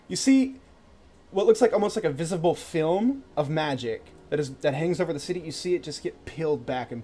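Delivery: dramatic scene narration. A narrator's reading. GM describes magic effect unfolding.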